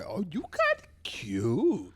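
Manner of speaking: put-on slow, drawn-out girl's voice; mock Brooklyn girl. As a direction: imitate a Brooklyn girl, stretching the words. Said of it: "If a girl was like".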